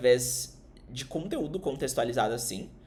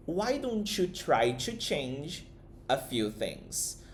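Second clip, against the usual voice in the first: slow model question; 3 dB quieter, much slower than usual. Shown as a demonstration. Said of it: "ela usa essa combinação".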